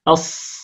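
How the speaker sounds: أص as a voiceless emphatic s; teacher sounding out sad; sad sound demo. Shown as a demonstration. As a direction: hiss أص with no voice. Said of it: "ننطق الصاد نقول أص".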